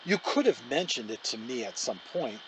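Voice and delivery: ordinary delivery, nothing marked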